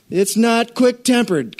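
slow, high emphasis on quick-tempered; reading the verse like a jab; pointed slow reading. Acted. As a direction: lean on it as if it stings. Reads verse slowly, pointed.

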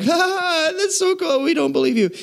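high, scoffing fake laugh and sneer; mocking disciples. Disciples scoffing at risen Jesus, high mocking laugh. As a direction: play the doubting disciples laughing him off.

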